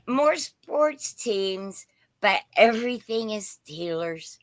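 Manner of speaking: ordinary talk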